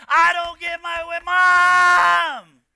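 loud, high tantrum wail, "Mom" stretched out; child throwing a fit. Acted. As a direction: yell like a bratty kid not getting their way. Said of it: "they're just throwing a fit".

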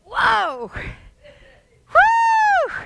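very high, loud whoop; excited whoop. Real, not acted. Preacher whooping in excitement. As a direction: let out a joyful whoop. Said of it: "I'm getting excited".

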